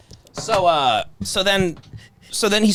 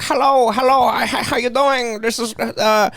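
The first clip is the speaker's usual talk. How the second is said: imitating a Thai waitress, higher and chirpy; higher mock Thai accent, rushed at the end; 4 semitones higher than usual. Waitress greeting the table, in a higher mock Thai accent. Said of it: "a lovely Thai waitress".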